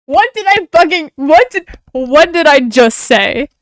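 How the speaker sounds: loud exasperated shout at the game; heated outburst. Real, not acted. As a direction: yell it in disbelief. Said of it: "I'm getting heated".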